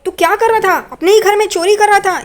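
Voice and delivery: high, acted telling-off; indignant scolding. Renuka confronts Tejas about stealing at home, acted scolding.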